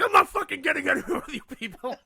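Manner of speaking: laughing while parodying his own outburst; laughing impression. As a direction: ham up the rant while cracking up.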